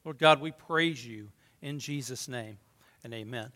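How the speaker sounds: soft, low closing prayer. Acted. Pastor closing the prayer before preaching, soft and low. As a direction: pray quietly to close.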